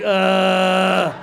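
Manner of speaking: long, loud groan of pain. Retold or imitated. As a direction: groan like you're doubled over hurting. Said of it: "he's bent over in pain".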